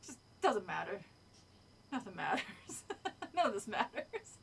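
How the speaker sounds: low cartoon impression, laughing; Carl. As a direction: deliver it low, then crack up. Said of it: "again, it was like".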